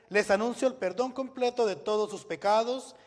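ordinary talk, nothing marked

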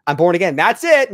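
loud, high mock declaration; mock complacent convert. Mocking a complacent convert's claim.

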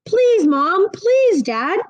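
high pleading please, please; child begging his parents for the name. A narrator's reading. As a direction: beg like a kid who wants it badly.

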